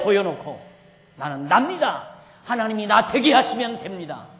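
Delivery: loud, full-force sermon climax; forceful declaration to the congregation; impassioned preaching. Real, not acted. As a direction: proclaim it boldly, driving each phrase home loudly.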